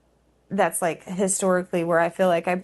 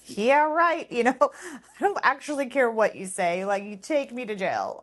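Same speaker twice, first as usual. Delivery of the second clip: high, scoffing comeback to the staff; retold defiance toward the orphanage director, pitched much higher; defiant retort; 5 semitones higher than usual. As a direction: throw back a dismissive yeah right. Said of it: "I was just so angry".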